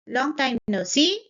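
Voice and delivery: "see" lifts upward with excitement; excited rising end. Shown as a demonstration. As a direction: greet with a rise on "see". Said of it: "I'm showing the excitement".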